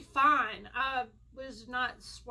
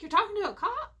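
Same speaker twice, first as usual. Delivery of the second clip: high, louder disbelieving question; mock shock from a friend; imitating an astonished friend; surprised; 8 semitones higher, 8 dB louder, faster than usual.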